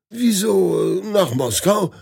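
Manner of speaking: high, astonished question; stunned disbelief at the destination. Acted. The newlywed learns he's on the wrong train, astonished. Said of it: "versetzt der Neuvermählte erstaunt".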